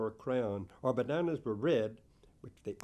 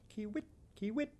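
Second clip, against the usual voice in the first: high, soft chirping kiwit; bird call; 10 semitones higher, 4 dB quieter than usual. Acted.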